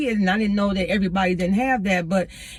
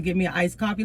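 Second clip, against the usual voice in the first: normal talking voice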